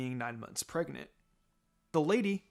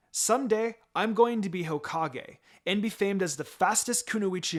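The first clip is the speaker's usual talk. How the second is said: bright excited boast, raised pitch and volume; 6 semitones higher, 4 dB louder than usual. A narrator's reading. Girl boasting to Kakashi about becoming Hokage, excited. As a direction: dream out loud with eager energy. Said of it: "I said excitedly".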